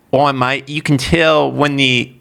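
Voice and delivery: put-on Australian mate voice; Australian accent. Player retelling what local mates said about Americans, attempted Australian accent. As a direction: imitate an Australian as best you can. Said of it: "the best Australian accent I got".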